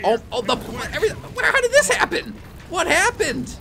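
high-pitched confused panic; shocked disbelief. Real, not acted. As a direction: squeak out the shock as it happens. Sudden chaos on screen.

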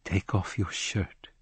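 a hushed slow order to undress; quiet order. A narrator's reading. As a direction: order it softly and slowly. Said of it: "she ordered".

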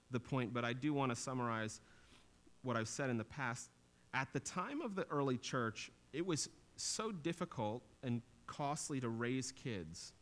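ordinary talk, nothing marked

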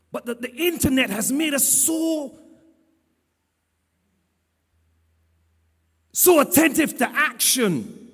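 loud sermon delivery with weighty pauses; forceful preaching. Real, not acted. Preacher rebukes congregation's craving for action, forceful preaching. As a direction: hammer the key words home to the congregation.

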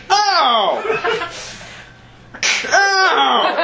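high laughing ow cries; mock pain cries. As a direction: yelp it high, half laughing. Arm injury, reenacted pain.

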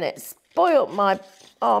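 ordinary talk, nothing marked

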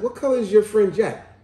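mother's question. Retold or imitated. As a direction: imitate a mom quizzing her son. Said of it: "She said".

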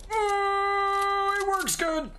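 thrilled it actually works; delighted surprise. Real, not acted. New weapon surprises him.

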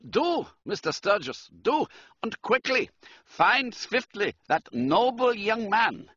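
high, urgent German count; emphatic German-accented appeal. A narrator's reading. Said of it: "He spoke with a German accent".

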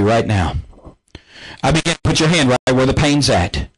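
low, commanding preacher's rebuke; healing prayer. Acted. Preacher rebuking sickness in viewers' bodies, low and commanding. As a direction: speak with authority over the pain.

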